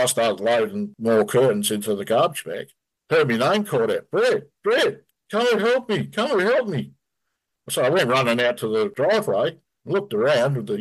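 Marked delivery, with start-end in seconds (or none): 4.1-6.9 high, urgent call for help. Retold or imitated. Friend calling Brett from the driveway for help, high urgent call. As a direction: shout for Brett across the yard.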